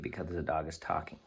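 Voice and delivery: usual speaking voice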